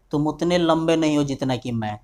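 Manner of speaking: ordinary talk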